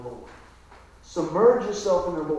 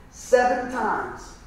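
"Seven" drawn out, slow and heavy; stress on seven; 4 semitones higher, much slower than usual. Real, not acted. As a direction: land "Seven times" slowly, weighty. Preacher emphasizing a count.

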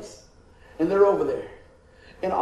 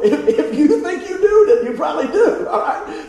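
laughing answer; 8 semitones higher, 7 dB louder than usual. Real, not acted. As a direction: chuckle out the advice.

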